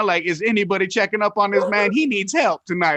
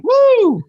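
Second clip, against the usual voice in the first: high, loud drawn-out "woo"; loud whoop; 10 dB louder than usual. Real, not acted. Host hooting after ribbing a stoned comic, loud whoop. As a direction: let out a big high whoop.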